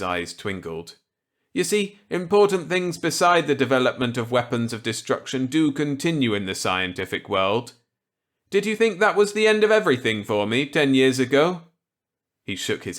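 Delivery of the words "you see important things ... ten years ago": higher, louder voice for kindly Millet; Millet's voice. Acted. Millet offering Walker a gambit, kindly. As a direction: play the genial old scientist warmly.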